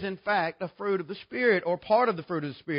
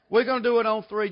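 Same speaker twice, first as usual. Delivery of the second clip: ordinary delivery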